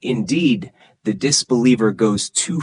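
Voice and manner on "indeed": usual speaking voice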